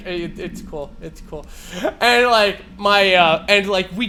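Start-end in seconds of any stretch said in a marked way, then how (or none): 0.1-1.4 soft, hushed comforting; calm him down gently; quiet girlfriend soothing; soothing reassurance. Retold or imitated. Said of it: "patting my leg".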